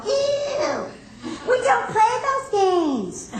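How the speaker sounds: mock disgusted woman. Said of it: "Women go".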